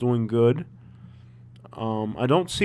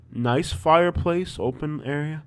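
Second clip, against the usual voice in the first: usual speaking voice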